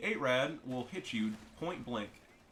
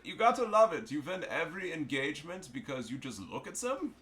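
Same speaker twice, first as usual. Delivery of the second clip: put-on high-pitched, emphatic German accent; 6 semitones higher, 4 dB louder than usual. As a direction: ham it up as an excited German. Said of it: "I'm doing the German accent".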